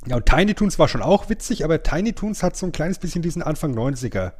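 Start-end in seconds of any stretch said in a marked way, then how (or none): none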